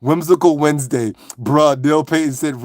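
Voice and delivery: usual speaking voice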